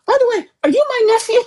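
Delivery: high, fast, chirpy quoted message; quoting her message. Retold or imitated. Aunt retelling her DM to a long-lost nephew, high and quick. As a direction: toss it off quickly in a bright high voice. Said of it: "sent him a D M that just was like".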